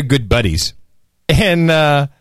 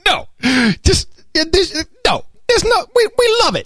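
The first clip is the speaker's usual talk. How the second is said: much higher, squeaky mock-official voice; mock official denial. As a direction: lampoon the official brushing it off.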